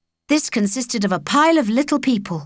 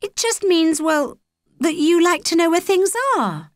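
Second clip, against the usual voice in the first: put-on lightly raised girl's voice, higher; Holly's voice; 10 semitones higher than usual. A narrator's reading. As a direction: voice the girl Holly a touch higher. Narrator voices young Holly.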